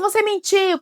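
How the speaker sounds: put-on accusing child. Child accuses parent of lying.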